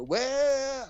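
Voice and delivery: high mock cartoon scream "Wah!"; a hammed-up panicked cartoon wail. Describing the crash scene, a mock cartoon scream. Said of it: "like, cartoon screaming".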